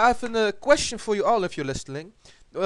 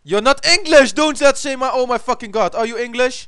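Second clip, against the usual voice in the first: loud, high-pitched telling-off; indignant scolding; 6 semitones higher, 8 dB louder than usual. Real, not acted. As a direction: scold your friend loudly. Scolds friend for imitating English.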